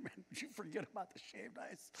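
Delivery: put-on sneaky murmured jab at the boy; hushed teasing.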